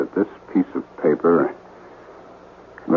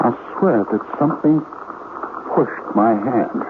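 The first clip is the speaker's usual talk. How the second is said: awed confession; 5 semitones higher than usual. Acted. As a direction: admit the uncanny with fear. Confessing eerie experience.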